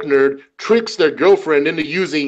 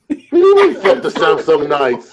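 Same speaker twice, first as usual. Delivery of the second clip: shocked and offended; indignant disbelief. Retold or imitated. Answering a hater from France, indignant disbelief. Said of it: "I'm like".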